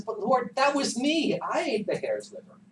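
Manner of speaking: high-pitched, protesting confession; Peter. Acted. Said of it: "Peter says".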